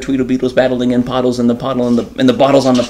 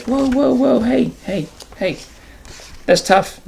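high, soft whoa, whoa of a startled man; startled Mister Knox; 12 semitones higher, 4 dB quieter, slower than usual. Retold or imitated. Knox reacting to the tricks, in high mock alarm. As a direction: back off in mock alarm, softly. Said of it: "Mister Knox is like".

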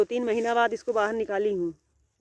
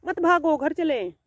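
pleading call; 4 semitones higher than usual. Real, not acted. Owner begging the runaway pet to come home, higher pleading call. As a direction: coax it back, raising your voice.

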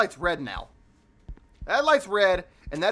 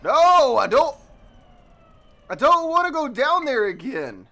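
loud panicked no; frightened, pleading; scared protest; 5 semitones higher, 8 dB louder than usual. Real, not acted. Panicked in horror game.

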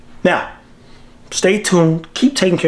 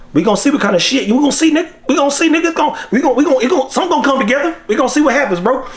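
high, excited mock bravado; hyped mixer; 12 semitones higher, faster than usual. Mixer boasting to a skeptic, high and excited. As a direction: hype it up like a reckless mixer.

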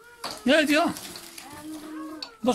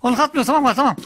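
calling out; 5 semitones higher, 8 dB louder than usual. Real, not acted. Father calling out over water.

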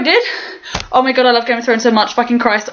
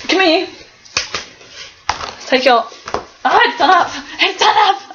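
coaxing voice to dog; 6 semitones higher, slower than usual. Real, not acted.

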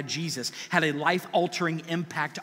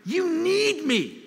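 high, loud scornful put-down; mock harsh Jesus; 9 semitones higher, 8 dB louder, slower than usual. Preacher voicing a Jesus who scolds Peter, loud and scornful. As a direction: sneer at him like a harsh master. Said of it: "Jesus doesn't say".

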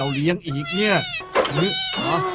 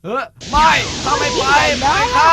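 struggling protest; 10 semitones higher, 6 dB louder than usual. Acted. Robot dragged off by soldiers.

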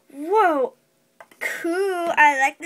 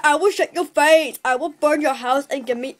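usual speaking voice

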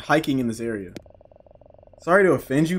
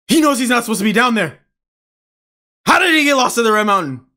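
mock panic; 9 semitones higher, 7 dB louder, faster than usual. Reading game text, mock panic.

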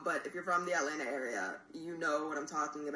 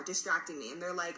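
ordinary delivery

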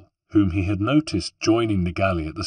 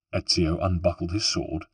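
plain reading voice

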